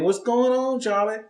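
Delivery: high, sing-out hello to a co-host; re-enacted greeting. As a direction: imitate your own entrance, pitched well up. Re-enacts his cheerful entrance.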